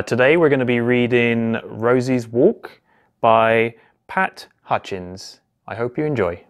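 plain reading voice

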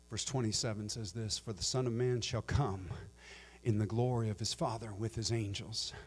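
soft, low Bible reading; hushed reading of the Gospel; quiet scripture reading. Acted. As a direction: read the verses softly and gravely.

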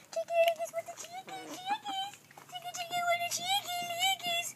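very high, soft sing-song nonsense; cooing to chickens. Real, not acted. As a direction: squeak softly to the pets. Baby-talking to the chickens.

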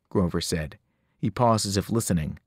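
ordinary delivery

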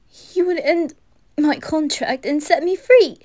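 high, loud, panicked disbelief; anguished pleading for reassurance; distraught denial. Acted. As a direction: protest desperately, refusing to believe it.

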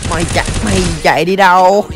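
ordinary talk, nothing marked

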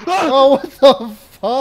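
startled. Real, not acted. Startled by a sound in game.

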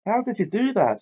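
very high delighted squeal. A narrator's reading. Angelina squealing at George in delight.

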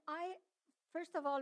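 ordinary talk

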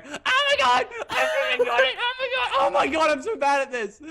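very high, loud yelling; frantic outburst. Real, not acted. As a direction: shriek in disbelief at your own play. Outburst at the finish line.

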